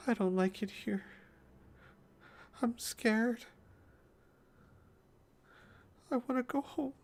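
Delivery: very high, small, frightened voice; tearful scared girl. Acted. Caged girl breaks down at kind words, small scared confession. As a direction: plead in a tiny high voice, pausing between sentences. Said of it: "her eyes get wet".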